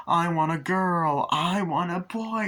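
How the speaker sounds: high, drawn-out demands; mock demanding. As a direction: act out squabbling parents. Acts parents' tug of war.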